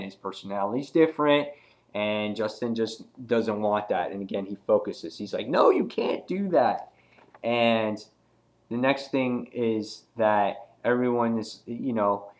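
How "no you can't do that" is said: higher-pitched mock objection; acted protest. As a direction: object urgently, imitating a comic character. Acted protest against destroying robot.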